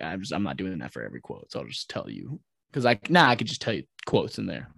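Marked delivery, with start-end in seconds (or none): 0.0-2.4 muttered to self. Retold or imitated. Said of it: "I was like".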